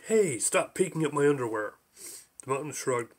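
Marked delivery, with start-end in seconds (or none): none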